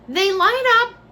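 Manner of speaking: high, slow, delighted exclamation; thrilled squeal of delight; excited. Real, not acted. Showing glowing ghost toys.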